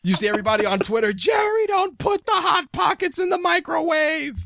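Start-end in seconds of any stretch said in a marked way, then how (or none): 1.1-4.3 hammed-up alarmed shout at Jerry; mock panicked shout. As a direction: yell it like a frantic fan online. Mock Twitter users panicking at Jerry.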